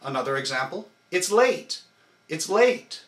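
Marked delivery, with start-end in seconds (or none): 1.1-1.8 late punched higher and louder from the core; It's late with the hit on late; stress on late. Shown as a demonstration. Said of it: "only on the big word late". 2.3-3.0 late punched higher and louder from the core; It's late with the hit on late; stress on late. Shown as a demonstration. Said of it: "only on the big word late".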